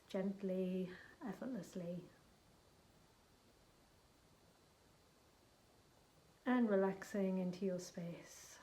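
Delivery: gentle guided visualisation; guided meditation. Acted. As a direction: ease the listener into the journey, unhurried. Leads a guided shamanic journey.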